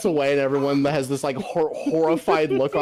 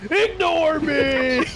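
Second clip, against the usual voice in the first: slow, drawn-out, higher cry of "Ignore me!"; Galactus parody catchphrase; 11 semitones higher, much slower than usual. Retold or imitated. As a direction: stretch it out like a pleading cosmic giant.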